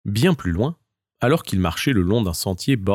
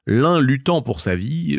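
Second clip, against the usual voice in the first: plain reading voice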